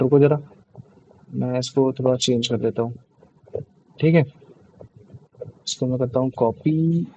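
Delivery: low, soft, halting self-talk; talking to oneself. Real, not acted. Teacher trying to copy and forward a message, quiet self-talk. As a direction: think aloud under your breath, with long pauses. Talking to himself while working laptop.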